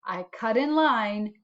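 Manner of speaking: ordinary delivery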